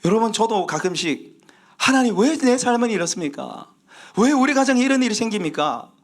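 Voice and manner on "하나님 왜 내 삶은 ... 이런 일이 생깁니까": high-pitched pleading outcry; crying out to God. Retold or imitated. Preacher re-enacts asking God about family troubles, crying out. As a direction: call out "why" in raised protest. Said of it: "왜를 하나님께 외칠 때가".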